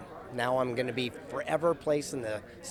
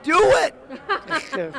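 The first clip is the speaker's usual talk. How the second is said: excited laughing shout; 13 semitones higher, 7 dB louder than usual. Real, not acted. Says friend may steal idea.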